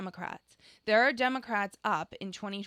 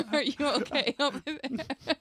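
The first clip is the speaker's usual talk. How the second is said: asked through laughter, drawn out; laughing; 4 semitones higher than usual. Real, not acted. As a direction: giggle as you ask. Laughing at the clip.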